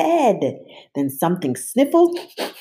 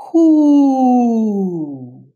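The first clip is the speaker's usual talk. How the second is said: higher, louder icy monster; 6 semitones higher, 8 dB louder than usual. A narrator's reading. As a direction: call out coldly. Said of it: "an icy voice called".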